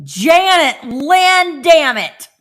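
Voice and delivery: imitating a mom yelling her full name, loud and slow; high, drawn-out loud scolding shout. An angry mom yelling at her daughter by full name, loud. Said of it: "when my mom used my whole name".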